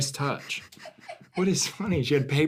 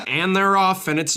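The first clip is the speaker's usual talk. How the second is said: higher-pitched racetrack commentary; ringing trackside commentator voice; Derby race announcer; 5 semitones higher, 3 dB louder than usual. Acted. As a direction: call the start of a horse race. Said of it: "the announcers of the Kentucky Derby".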